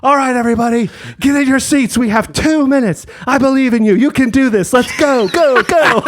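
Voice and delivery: high, bright cheerleader urging; rally the cabin like a cheerleader; peppy flight attendant. Retold or imitated. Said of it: "the flight attendant gets on and goes".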